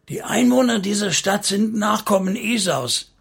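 put-on old Kaleb warns the council, voice high and quavering; trembling ghostly voice. A narrator's reading. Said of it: "seine Geisterstimme zitterte".

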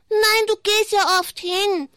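high, slow squeaky puppet chatter; a cheeky little puppet, drawn out high. Acted. Puppet teasing the host about church, high and slow.

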